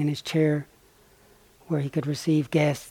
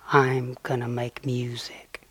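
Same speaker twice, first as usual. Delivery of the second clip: whispered resolve; 3 semitones lower, 5 dB quieter, slower than usual. Retold or imitated. Donkey's quiet resolve.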